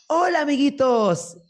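put-on high, slow squeaky kids' entertainer greeting; overly sweet children's party host; bright squeaky sing-song welcome. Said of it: "No quiero de que".

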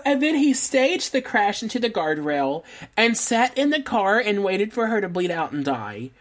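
normal talking voice

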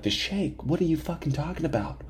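soft, high incredulous aside; quietly bewildered retort; baffled disbelief. Retold or imitated. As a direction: question it under your breath. Baffled reply to stranger, retold.

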